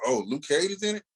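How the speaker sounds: ordinary delivery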